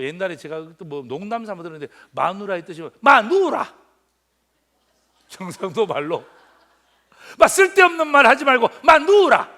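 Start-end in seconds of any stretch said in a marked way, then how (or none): 3.0-3.8 loud, high bark of a husband calling his wife; gruff call. Retold or imitated. 경상도 남편이 아내 부르는 흉내. 7.4-9.5 loud and high, shouting down the wife; gruff order. Retold or imitated. Hushing wife, Gyeongsang style.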